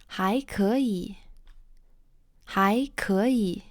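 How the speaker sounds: "还可以" said slowly, twice, tones clear; slow model phrase. Shown as a demonstration. Teacher models "还可以" slowly for students. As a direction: draw out "还可以" slowly for learners. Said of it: "you can say something like".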